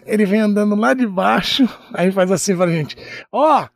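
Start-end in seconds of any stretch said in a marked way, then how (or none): 3.3-3.7 higher, shouted mock announcement; calling out. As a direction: call it out as if walking up to reporters. Director calling out news to reporters.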